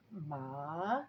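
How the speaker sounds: "ma" dipping down, then rising; third tone. Shown as a demonstration. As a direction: scoop "ma" low and back up. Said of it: "goes down and then it goes up".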